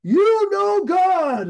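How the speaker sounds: long, high-pitched mock holler; imitated yelling. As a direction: mimic a man shouting up from the street. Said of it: "He's yelling at me".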